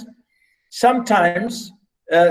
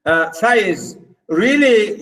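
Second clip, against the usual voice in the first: ordinary delivery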